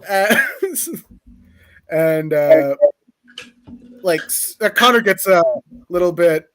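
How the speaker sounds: ordinary talk, nothing marked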